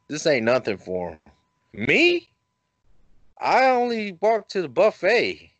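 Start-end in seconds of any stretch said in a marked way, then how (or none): 1.7-5.5 high, squeaky punchline about himself; comic self-mockery. Real, not acted. Storyteller joking about his own fitness, high and playful. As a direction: pitch it up for the joke.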